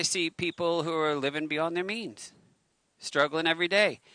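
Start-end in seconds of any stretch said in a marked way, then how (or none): none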